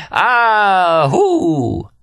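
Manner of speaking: high, long wailing "Ah, hoo"; laughing whoop. A narrator's reading. Remy overcome by his own laughter, long high whoop. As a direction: hold the whoop high, then let it fall. Remy's great laugh ends speech.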